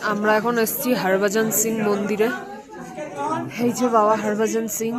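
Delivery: loud outdoor announcing; calling out the place name. Real, not acted. Announcing arrival at the temple outdoors.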